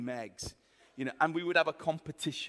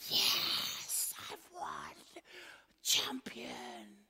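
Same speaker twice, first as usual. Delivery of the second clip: whispered victory cheer; imitating a smug sister's hushed celebration; gloating stage whisper; 4 semitones higher, 7 dB quieter, much slower than usual. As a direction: hiss it out triumphantly without voice. Re-enacts sister's gloating win.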